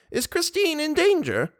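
very high, worried question; alarmed cry. A narrator's reading. Old mother fears for her daughter, high alarmed cry. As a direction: pitch it right up like a frightened old lady. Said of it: "she cried".

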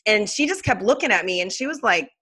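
ordinary talk, nothing marked

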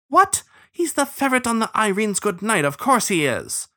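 high, indignant starling outburst; high-pitched incredulous protest. A narrator's reading. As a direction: squawk it in disbelief at your partner. Starling disbelieves his bloodhound partner.

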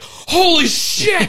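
high, slow, stretched-out "Holy shit!"; amazed outburst. Retold or imitated. Deborah Messing's character leaps up in disbelief, amazed high cry. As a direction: blurt it out in stunned delight. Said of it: "shoots up to her feet, like".

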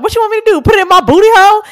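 high, loud, fast sassy comeback; sassy loud retort. Retold or imitated. Friend retells a bathroom girl snapping back, high and loud. Girl's retort quoted in story.

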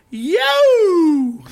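long, high, loud coyote-like howl; yelling. Retold or imitated. That one group waking sleeping campers, yelling. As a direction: howl "yo" to wake the whole camp. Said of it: "And starts yelling".

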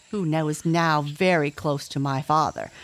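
ordinary delivery

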